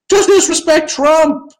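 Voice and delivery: JUST stretched high and slow; sarcastic rallying call; emphatic. Real, not acted. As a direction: lean hard on "Just" up high. Ranting advice to players.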